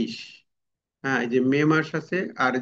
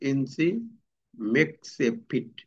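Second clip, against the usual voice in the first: very slow, each word apart; slow dictation; 4 dB quieter, much slower than usual. Shown as a demonstration. Teacher reading an example slowly to students. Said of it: "যেমন twelve".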